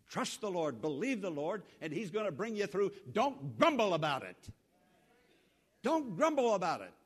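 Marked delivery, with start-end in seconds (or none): 3.1-6.9 firm loud warning. Acted. Preacher repeats a warning.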